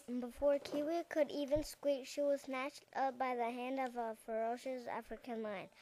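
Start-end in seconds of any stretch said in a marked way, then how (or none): none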